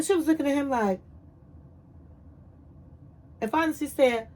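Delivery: ordinary delivery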